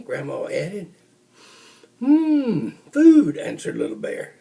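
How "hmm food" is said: excited sniff. Acted. Bear sniffs out food.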